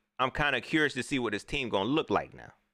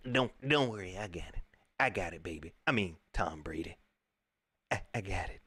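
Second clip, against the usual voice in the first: soft reassuring mock; 4 semitones lower, 6 dB quieter than usual. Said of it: "Jerome Bogle like".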